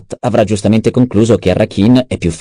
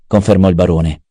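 plain reading voice

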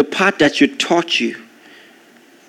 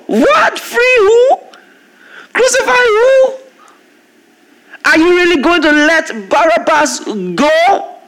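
can't believe what they chose; shocked shout, pitched way up; incredulous outcry; 12 semitones higher than usual. Real, not acted. Outraged disbelief at crowd's choice.

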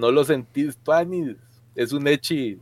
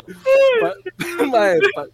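laughing; 4 dB louder, slower than usual. Real, not acted. Laughing fit over nickname.

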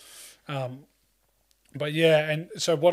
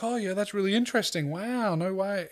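higher-pitched, casual mock interest; nonchalant students; 7 semitones higher than usual. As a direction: imitate students taking it all in stride. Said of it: "like, it's nonchalant".

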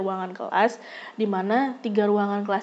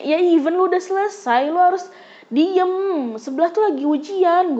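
higher, louder angry order; heated scolding; 7 semitones higher, 4 dB louder than usual. Retold or imitated. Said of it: "gue terpancing emosi". (membentak marah dengan nada tinggi)

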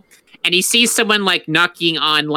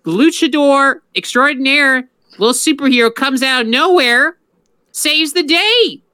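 excited hype; 9 semitones higher than usual. Real, not acted. Hyping a cartoon hero.

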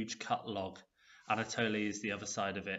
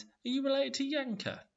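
put-on young girl voice; 3 dB louder than usual. A narrator's reading. Elena's child voice, suspicious.

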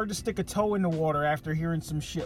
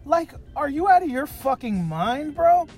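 can't believe what he's seeing; exasperated disbelief; 7 semitones higher, 5 dB louder than usual. Retold or imitated. Mocking a gym guy in leggings, incredulous and exasperated. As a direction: pitch jumps way up on a loud incredulous bro.

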